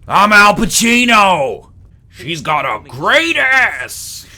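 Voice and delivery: high, loud bellow with stretched words; Al Pacino. Acted. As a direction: roar it slowly, hammed right up. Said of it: "that's my Al Pacino impression".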